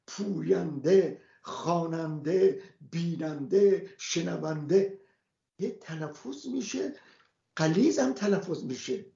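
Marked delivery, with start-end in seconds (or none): 0.1-5.0 slow list, each final h pronounced; sounded final h. Shown as a demonstration. Teacher listing words to show the sounded final h. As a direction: draw out each word and voice its ending h. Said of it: "های ملفوظه".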